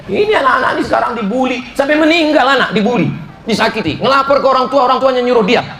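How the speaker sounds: outraged sermon climax; indignant preaching. Acted. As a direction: drive it up high and fast with disbelief. Indignant preaching about bullied children.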